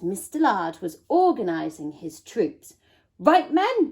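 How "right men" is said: commanding. A narrator's reading. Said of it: "he commanded".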